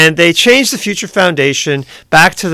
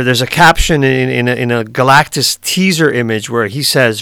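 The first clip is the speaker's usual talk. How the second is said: ordinary talk, nothing marked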